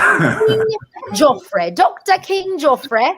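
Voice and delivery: put-on British accent. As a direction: announce the title like a hammed-up British herald. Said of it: "I should say your name with a British accent".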